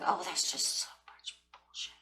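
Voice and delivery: whispered dismissive scoff; mocking her old self in a whisper; scornful whisper. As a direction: breathe it out like it's all nonsense. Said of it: "I was like".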